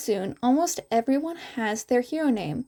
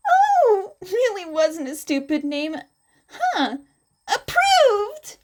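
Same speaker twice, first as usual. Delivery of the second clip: much higher, squealing with delight; gushing teacher; 9 semitones higher, 5 dB louder than usual. Acted. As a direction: gush like a thrilled teacher. Midnight gushing over hero names.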